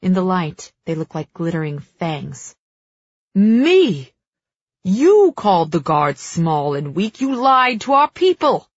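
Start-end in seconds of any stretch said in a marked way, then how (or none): 3.3-8.7 loud, high-pitched outburst; indignant shouting in a bitter quarrel; furious accusation. A narrator's reading. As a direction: hurl the blame back in outrage. Queen and king in furious quarrel.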